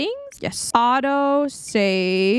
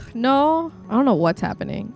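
ordinary talk, nothing marked

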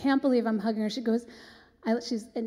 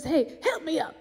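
higher-pitched star impression; 6 semitones higher than usual. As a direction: call it out lightly as the diva. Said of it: "And she goes".

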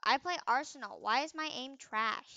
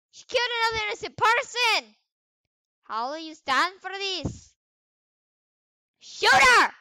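high loud dramatic accusation; hammed-up cry of justice; mock outrage; 8 semitones higher, 12 dB louder than usual. Gamer rallying players against Gloria, mock outraged cry.

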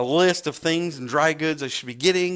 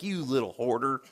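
disapproving remark; 2 semitones lower, 6 dB quieter than usual. Retold or imitated. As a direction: imitate a stranger judging under his breath. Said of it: "like, shaking his head".

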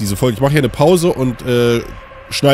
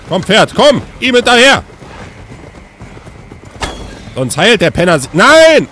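panicking gamer; 7 semitones higher, 4 dB louder than usual. Real, not acted. Chasing prey in panic.

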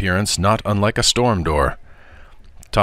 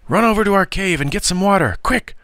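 high, fast urgent order; rushed, high-pitched command; urgent cry; 13 semitones higher than usual. A narrator's reading. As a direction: send him running for help. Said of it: "cried Keenan".

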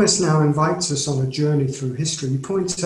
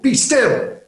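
much higher and louder, a sharp order; stern command; 8 semitones higher, 7 dB louder than usual. Shown as a demonstration. Preacher voicing God's command to the panicking, forceful. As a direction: bark it as a command to stop. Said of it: "it is a command".

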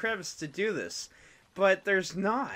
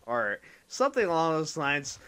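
ordinary talk

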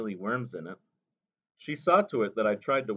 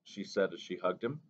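ordinary delivery

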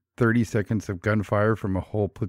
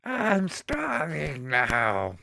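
slow, high stoned drawl; stoner voice; 6 semitones higher, 3 dB quieter, much slower than usual. Pretend. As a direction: drag it out like a stoner. Stoned munchies joke.

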